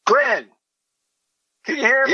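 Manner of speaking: loud high call. Acted. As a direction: shout over the radio. Caller shouting to astronaut.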